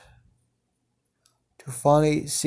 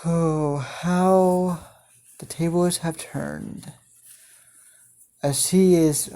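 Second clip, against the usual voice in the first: ordinary talk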